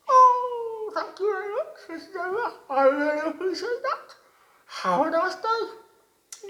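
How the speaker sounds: high, loud, bright-eyed little frog voice; wide-mouthed frog voice. A narrator's reading. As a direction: squeak it out like an eager little frog. Frog thanks the zebra.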